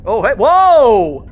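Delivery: loud, drawn-out high whoa; startled. Real, not acted. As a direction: yelp in surprise, stretching it out. Startled by new hiding spot.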